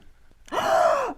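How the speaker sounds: high startled gasp; loud shocked gasp; sharp gasp. Real, not acted. Piece breaks during assembly.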